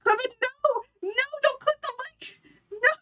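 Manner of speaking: very high, cracking into laugh-crying; squealing laughter. Real, not acted. Streamer squealing at chat not to clip the leg, laugh-crying. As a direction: squeak out no between helpless laughs.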